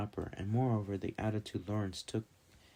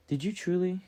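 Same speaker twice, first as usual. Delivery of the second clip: much higher, indignant question; scornful, angry disbelief; 10 semitones higher than usual. A narrator's reading. Woman challenging a merchant over a debt, indignant scorn. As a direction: demand it in high-pitched outrage. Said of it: "anger and scorn on her face".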